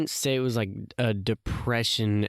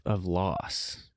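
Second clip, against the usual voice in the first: usual speaking voice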